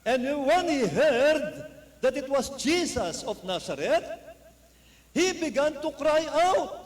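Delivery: slow, high, loud reading of the verse; dramatic scripture reading. Acted. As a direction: read it like a proclamation, drawn out. (dahan-dahan at malakas na pagbasa ng talata)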